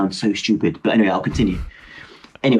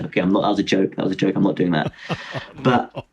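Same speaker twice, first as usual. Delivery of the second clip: normal talking voice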